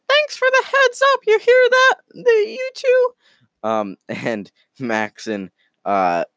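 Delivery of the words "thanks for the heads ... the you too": very high squeaky cartoon squirrel; Space Squirrel. Space Squirrel thanks his friend for the warning, very high squirrel voice. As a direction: squeak it out in shrieky falsetto. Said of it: "Space Squirrel says".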